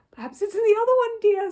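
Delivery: high, fast, loud hopeful voice. A narrator's reading. Mrs Brown, hoping to rescue the note, chirps high and fast. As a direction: chirp it up brightly to cheer him. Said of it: "said Missus Brown hopefully".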